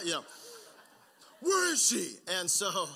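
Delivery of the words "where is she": loud, high mock superhero shout; Batman. Comic aside on dad's voice, loud superhero impression. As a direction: yell it as a hammed-up movie hero. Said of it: "it sounded like Batman".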